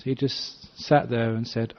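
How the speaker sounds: ordinary delivery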